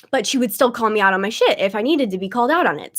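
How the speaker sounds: normal talking voice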